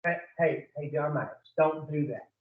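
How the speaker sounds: soft, low acted warning; little inner voice. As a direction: say it quietly, like a voice inside the head. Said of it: "first time you hear that voice say".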